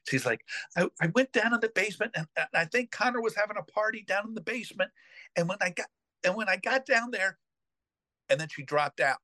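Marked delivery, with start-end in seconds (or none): 0.8-7.4 high, fast, frantic babbling; panicked retelling. Retold or imitated. Nanny panicking on the phone to her employer, high and fast. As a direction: rush it out high like a panicked housekeeper. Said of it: "Shirley freaking out".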